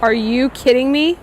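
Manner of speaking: loud, incredulous excitement; wowed and loud; amazed outburst. Real, not acted. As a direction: exclaim it loudly, blown away by the invention. Marvels at French invention.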